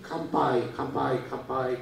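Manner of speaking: usual speaking voice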